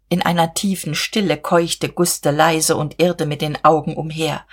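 ordinary delivery

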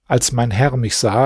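usual speaking voice

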